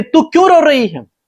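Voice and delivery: very high, emphatic questions; God questioning Hagar. Retold or imitated. God asks Hagar why she cries, high emphatic questioning. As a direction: pitch each question way up, then drop at the end. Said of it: "परमेश्वर पहली बात उससे कहते हैं".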